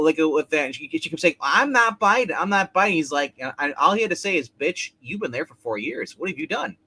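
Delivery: ordinary delivery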